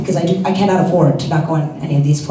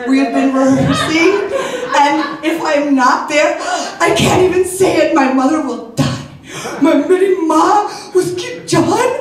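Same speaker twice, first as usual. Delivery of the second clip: melodramatic plea; 8 semitones higher, faster than usual. Retold or imitated.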